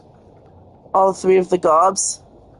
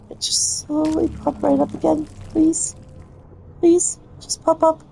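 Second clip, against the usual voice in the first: pleading; 6 semitones higher, 4 dB quieter than usual. Real, not acted. Begging the game to cooperate.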